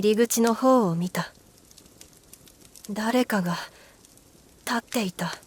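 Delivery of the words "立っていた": plain reading voice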